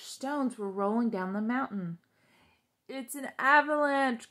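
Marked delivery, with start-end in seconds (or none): 2.9-4.3 loud alarmed cry. A narrator's reading. Toad warning Frog of falling stones, loud alarmed cry. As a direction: yell the warning out. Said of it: "cried Toad".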